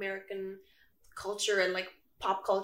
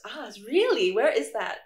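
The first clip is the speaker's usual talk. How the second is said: intrigued surprise; 2 semitones higher, 7 dB louder than usual. Retold or imitated. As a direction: imitate curious, impressed classmates. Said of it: "I always get a couple oohs".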